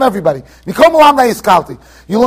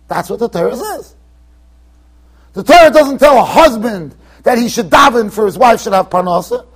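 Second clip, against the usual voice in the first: usual speaking voice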